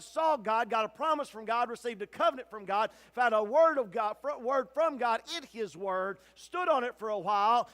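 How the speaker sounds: ordinary talk, nothing marked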